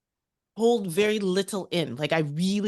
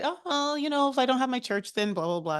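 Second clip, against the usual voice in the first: higher-pitched hedging reply, sliding back down; noncommittal mother; 6 semitones higher than usual. Retold or imitated. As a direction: imitate a mom putting you off. Mother's noncommittal answer, acted out.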